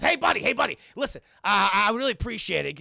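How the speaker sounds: acted call for help. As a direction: get a stranger's attention, loud and urgent. Retells character calling to passerby.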